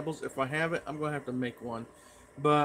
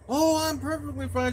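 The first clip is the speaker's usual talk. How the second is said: imitating Mom hollering back, much higher; high-pitched mock mother's yell; 12 semitones higher, 4 dB louder than usual. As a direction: call it out in a put-on mom voice. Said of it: "and she yells".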